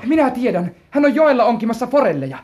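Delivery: put-on an excited boy who knows the answer; eager and loud. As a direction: blurt it eagerly, racing ahead. Boy eagerly offers to fetch hunter.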